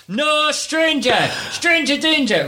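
high, stretched-out mock shout; demonstrated shout. Shown as a demonstration. Dad teaching his daughter to shout at strangers, high mock shout. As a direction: call it out slowly, pitch way up. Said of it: "You have to shout".

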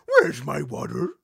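slowed, loud goofy dog voice; Scooby-Doo voice. Retold or imitated. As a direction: drawl it out like a cartoon Great Dane. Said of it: "Like Scooby-Doo".

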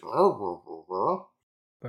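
put-on wobbly roar noise; imitated roaring. Whenever someone comes along, imitated roaring. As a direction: mimic a creature's repeated roar.

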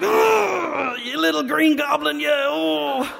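high, strained mock cry of pain; pained Spider-Man. Spider-Man punched by a child, crying out in high mock pain. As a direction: squeal an insult through the pain.

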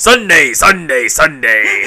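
loud event-promo chant, each word stepping down; radio promo hype. Shown as a demonstration. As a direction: belt it like a rally advert. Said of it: "You gotta go like".

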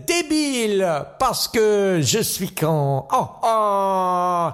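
milk every syllable, fading to the end; Gene Kelly. Acted. Kelly drawling mock French to a finish.

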